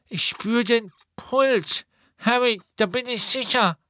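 put-on high slow boy's voice, sure of himself; Neville, relieved. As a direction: reassure Harry as Neville. Neville checks Hermione's pulse.

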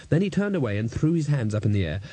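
plain reading voice

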